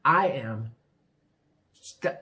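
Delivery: ordinary delivery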